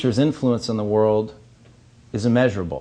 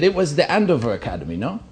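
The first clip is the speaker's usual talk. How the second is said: put-on peer over your spectacles like an old German dean; a lightly put-on German accent, much higher; elderly German dean; 5 semitones higher, faster than usual. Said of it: "delightful elderly German woman".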